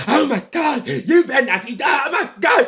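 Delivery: shouted, high mock-mother panic; hammed-up shriek of a scandalised mom; mother overreacting. Imitating his overreacting mother shouting at her teenage son. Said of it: "she's overreacting".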